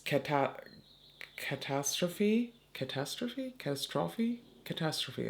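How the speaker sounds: soft, questioning attempts at a word; unsure, trying out. Real, not acted. Vlogger testing how a shade name is pronounced, unsure and quiet. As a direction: try the word out quietly, tentatively.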